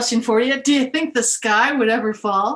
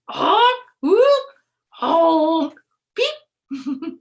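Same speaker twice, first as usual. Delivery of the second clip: bird calls; 7 semitones higher, 7 dB louder, much slower than usual. Acted. Birds calling running home.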